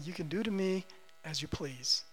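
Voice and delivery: soft, low voice of yielding; quiet surrender. Acted. Believer stepping out in the open to God, softly submissive. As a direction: drop right down in submission.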